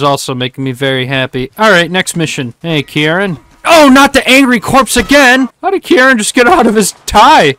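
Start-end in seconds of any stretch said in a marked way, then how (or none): 3.6-7.5 higher, louder exasperated rant; exasperated outburst. Real, not acted. Gamer attacked by the angry corpse again, higher exasperated outburst. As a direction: throw up your hands at the chaos.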